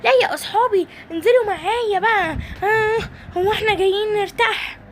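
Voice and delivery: high-pitched whiny coaxing; pleading toy voice. Acted. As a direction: coax the lazy friends, high. Simba urges tired friends. (صوت طفولي عالٍ يلحّ)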